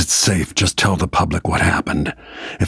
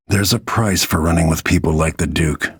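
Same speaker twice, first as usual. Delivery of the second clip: usual speaking voice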